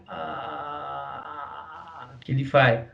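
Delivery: vocal fry. Shown as a demonstration. Teacher demonstrates the basal register. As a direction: let the sound crackle in fry. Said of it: "e que é o fry".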